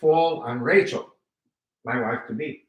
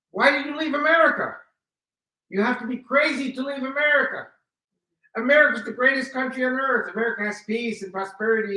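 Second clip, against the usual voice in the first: higher, incredulous wife lecturing him; scolding wife; 7 semitones higher than usual. Retold or imitated. Wife scolding him for leaving America, high incredulous voice. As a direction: voice her disbelief that anyone would leave America.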